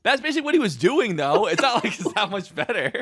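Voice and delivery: high, fast incredulous; exasperated. Real, not acted. Host rebutting his co-host, high and fast. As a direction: fire it back high and fast. Arguing, laughing.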